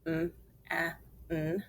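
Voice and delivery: "M-A-N" as letter sounds, not letter names; three separate sounds with gaps; sounded out. Shown as a demonstration. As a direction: sound out man one phoneme at a time. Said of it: "Let's finger spell it".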